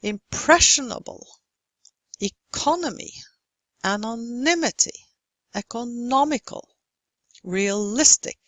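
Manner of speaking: imPRESsionable, eCOnomy, anoNYmity, ecoNOmical, reaLIStic; stressed syllable marked. Shown as a demonstration. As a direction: punch PRES, CO, NY, NO and LIS. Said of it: "Which syllable receives stress in these?".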